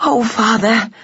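high-pitched dismayed cry; young daughter mouse, distressed; anxious cry. A narrator's reading. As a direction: cry out in concern. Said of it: "cried Flora".

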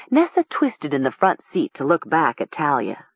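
ordinary delivery

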